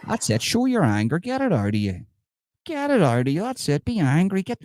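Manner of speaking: goading encouragement, pitch jumping up; egging on. Acted. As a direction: urge them on with high, swooping cries. Egging someone on to be angry.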